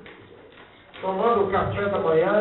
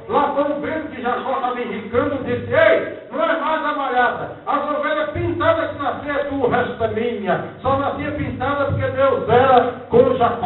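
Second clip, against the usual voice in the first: preaching, getting louder line by line; 4 semitones higher, 5 dB louder than usual. Acted. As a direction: drive the story home to the church. Preacher builds up Jacob story. (pregação cada vez mais alta)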